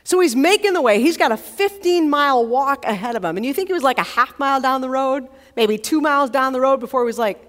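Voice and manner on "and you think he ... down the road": ordinary delivery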